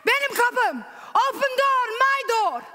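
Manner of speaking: imitating a husband's furious shouting. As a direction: scream it through the door in a rage. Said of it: "he red with anger".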